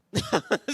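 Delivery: high, loud laugh that spills out; sudden amused cackle; loud burst of laughter. Real, not acted. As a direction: laugh out loud at your own quip. Laughs at own joke.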